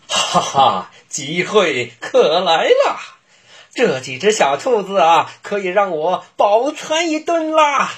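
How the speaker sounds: high, gloating wolf voice with a laugh; a greedy wolf relishing his plan; big bad wolf voice.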